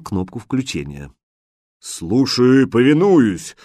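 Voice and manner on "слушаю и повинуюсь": slow, loud gruff genie bark; rough genie voice. A narrator's reading. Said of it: "грубым голосом Джина рявкнула трубка".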